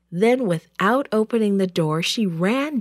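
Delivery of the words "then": ordinary delivery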